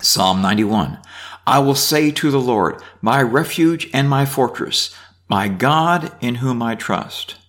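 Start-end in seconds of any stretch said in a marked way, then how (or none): 1.5-7.4 raised, measured Bible reading; reading scripture aloud. Acted. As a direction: read the psalm out to the class. Reads psalm aloud.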